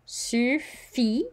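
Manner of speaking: "Suffit" as sü-FEE, T silent, pitch high; "Suffit" with rounded U and no final T; French model word. Shown as a demonstration. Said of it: "we don't pronounce the T".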